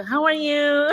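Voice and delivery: jokey practice "how are you"; practised greeting. Pretend. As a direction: try out the greeting with laughter behind it. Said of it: "matuto na tayo".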